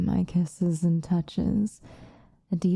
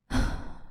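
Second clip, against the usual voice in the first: soft sigh; 5 dB quieter than usual. Acted. Weary at captive's answer.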